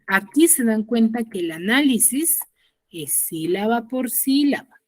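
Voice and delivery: ordinary talk, nothing marked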